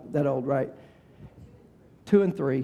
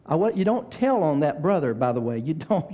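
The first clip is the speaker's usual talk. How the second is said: ordinary delivery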